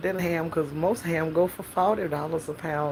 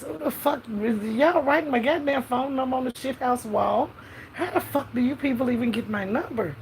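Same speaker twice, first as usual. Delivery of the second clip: higher-pitched mock outrage; indignant outburst; 6 semitones higher than usual. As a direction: demand answers in hammed-up exasperation. Acted outrage at strangers calling her.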